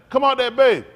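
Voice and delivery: put-on kids yelling taunt. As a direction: holler it across the playground. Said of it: "You know they be out there".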